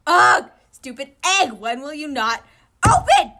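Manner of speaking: loud, frustrated shout at the egg; ticked off. Acted. Okawa yelling at the egg that won't crack, angry. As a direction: yell at the stubborn egg. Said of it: "He was getting ticked off".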